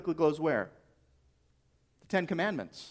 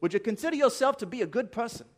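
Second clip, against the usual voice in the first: higher, quick imitation New Zealand accent; Kiwi accent; 5 semitones higher, faster than usual. Preacher mimicking an evangelist's question to students, mock Kiwi accent. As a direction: put on a badly faked Kiwi accent. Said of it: "in his delightful Kiwi accent".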